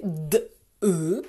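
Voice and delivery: "D, E," as two separate drawn-out sounds; sounded-out letters. Shown as a demonstration. Teacher makes the word deux sing for pupils, sounded out. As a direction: sound out "D, E," slowly, one sound at a time. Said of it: "je fais chanter".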